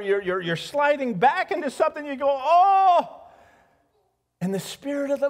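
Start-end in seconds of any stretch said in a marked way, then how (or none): 2.3-3.1 high loud cry of dismay; mock despairing cry; anguished oh. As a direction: wail an oh of despair.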